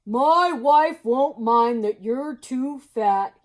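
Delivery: slow, loud, friendly moose. Acted. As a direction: draw it out slowly and loudly. Mister Moose voice.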